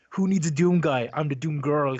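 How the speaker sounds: slow, loud mocking boast; taunting. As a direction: brag it out like a taunt. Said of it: "the actress was taunting people".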